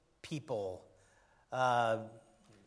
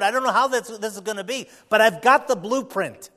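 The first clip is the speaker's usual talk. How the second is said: loud, raised, urgent sermon voice; full-force preaching; 5 semitones higher, 11 dB louder than usual. Real, not acted. Preacher to church about facing the new year, full-force preaching. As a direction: preach it out hard to the congregation.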